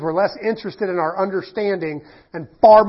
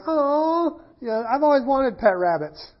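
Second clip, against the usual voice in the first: higher, lightly voiced gushing woman; mock delighted host; 8 semitones higher, slower than usual. Pastor mimicking dinner host gushing about pet rabbits, higher mock voice. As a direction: play her eager, sing-song delight over rabbits.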